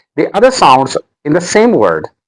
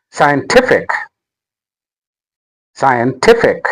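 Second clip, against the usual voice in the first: "scientific" with TIF made prominent; stress on third syllable. Shown as a demonstration. Teacher modelling word stress, "scientific" stressed scien-TIF-ic. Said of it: "The stress is on".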